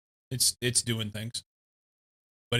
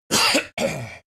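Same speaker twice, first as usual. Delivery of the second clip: coughing; 5 dB louder than usual. Real, not acted. Rice Krispie lung.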